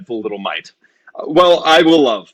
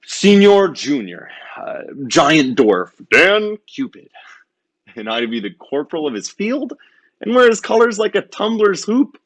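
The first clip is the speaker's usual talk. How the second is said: hammy stage actor; 7 semitones higher than usual. Acted. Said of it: "hammy Cupid soliloquy".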